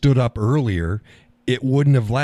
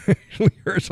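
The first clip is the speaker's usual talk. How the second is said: laughing complaint; 11 semitones higher, faster than usual. Real, not acted. Laughing about aching face.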